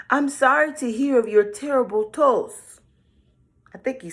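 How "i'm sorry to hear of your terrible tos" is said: wolf in a high, loud squeak; high squeaky voice. A narrator's reading. Wolf fooling grandma, high squeaky voice. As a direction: put on a fake squeaky voice. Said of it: "he said in a high squeaky voice".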